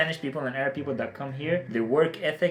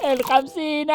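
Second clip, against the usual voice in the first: announcer call; 13 semitones higher, 5 dB louder than usual. Acted. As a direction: sing out the show name like a jingle. Show name jingle call.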